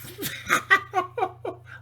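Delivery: a high giggle at the joke; real amused high cackle; high mocking laugh. Real, not acted.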